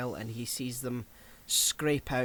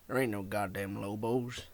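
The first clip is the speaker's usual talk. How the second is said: put-on southern accent; 6 semitones lower, 5 dB quieter than usual. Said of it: "That's really good".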